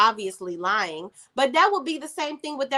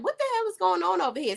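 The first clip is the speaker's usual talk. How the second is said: mock confused demand; 8 semitones higher, faster than usual. Gary barging in, confused.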